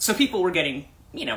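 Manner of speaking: usual speaking voice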